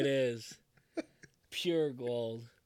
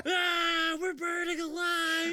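burning scream; 13 semitones higher, much slower than usual. Retold or imitated. Retold burning car scene.

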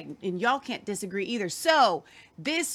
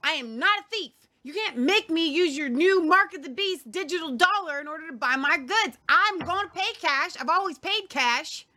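imitating an indignant old British man, higher; hammed-up British accent, high and firm; mock British accent; 6 semitones higher, 3 dB louder than usual. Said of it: "in his little British accent".

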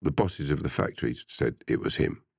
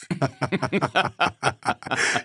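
high-pitched burst of laughter; laughing; 10 semitones higher than usual. Real, not acted. Storyteller amused by his John Wayne quote, laughing. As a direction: crack up at your own line.

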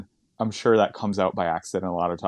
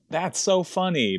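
slow, high, gushing "That's so funny"; gushing audience; 9 semitones higher than usual. Retold or imitated. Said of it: "L A audiences are like".

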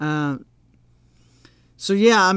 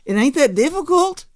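emphatic; 9 semitones higher, 6 dB louder than usual. Real, not acted. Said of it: "preaching emphatic".

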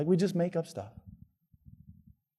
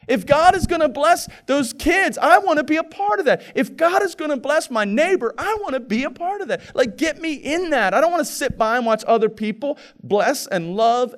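high and loud, pressing; preaching at full force; 10 semitones higher, 5 dB louder than usual. Acted. Pastor urging the congregation to give, preaching hard. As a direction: urge the church to join in.

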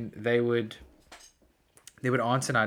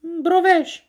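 very high, loud squeal of a name; high-pitched prank-call voice; prank caller; 11 dB louder, slower than usual. Acted. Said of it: "I'd go".

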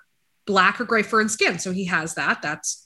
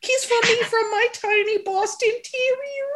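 sweet high pet voice, much higher. Real, not acted. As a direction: coo at the little dog. Baby-talking to the dog.

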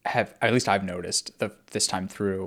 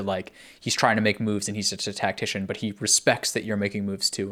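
ordinary delivery

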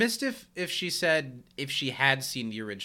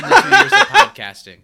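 much higher and louder through real laughter; cracking up mid-sentence; laughing through the words; 14 dB louder than usual. Real, not acted. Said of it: "the most offensive thing to me".

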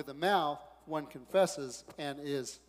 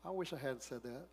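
regretful murmur; 3 semitones lower, 9 dB quieter than usual. Retold or imitated. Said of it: "Some of you are going".

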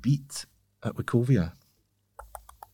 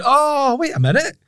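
mock realisation. Recalls recognising the player.

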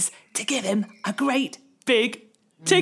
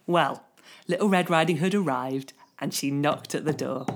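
plain reading voice

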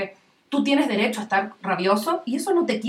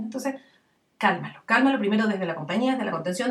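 usual speaking voice

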